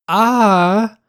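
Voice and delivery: sudden high yelp of surprise; a quick shocked "Ah!"; startled cry. Acted. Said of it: "interrupted by a startled cry".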